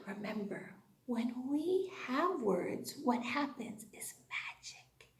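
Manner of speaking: secret whisper. Acted. Teacher whispers that words are magic to her class.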